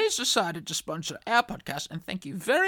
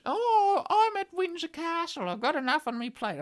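lightly voiced Queen, pitched up; mock Queen voice; 8 semitones higher than usual.

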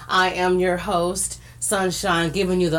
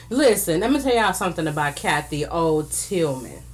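ordinary talk, nothing marked

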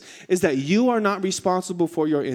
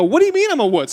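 raised-voice comic indignation; indignant protest; 7 semitones higher, 5 dB louder, faster than usual. Retold or imitated. Husband objecting to wife calling him a wuss, indignant. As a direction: protest the insult, higher pitched. Acted protest at wife's insult.

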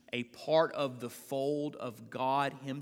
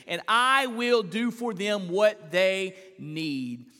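full-force preaching; 7 semitones higher, 8 dB louder than usual. Acted. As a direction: build each "I will" to the room. Preacher proclaims God's promise.

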